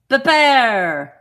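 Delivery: loud French-style PEPPER, long final syllable; French accent. Acted. Repeating the French label, exaggerated French accent. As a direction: stretch PEPPER out à la française. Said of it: "They're in French".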